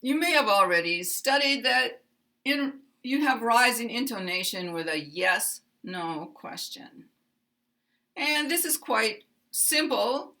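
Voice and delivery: ordinary talk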